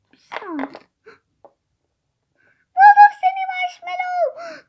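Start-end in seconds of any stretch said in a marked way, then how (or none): 2.7-4.6 squeaky high gotcha voice. Pretend. Seeker finds toy, teasing voice.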